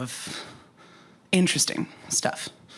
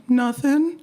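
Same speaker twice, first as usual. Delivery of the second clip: high, louder, unconvincing denial; put-on innocent high voice; telltale false "nothing"; 10 semitones higher, 5 dB louder than usual. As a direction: pitch it way up, pretending all is fine. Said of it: "my inflection and the tone in my voice".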